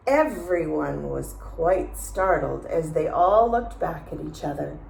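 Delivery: plain reading voice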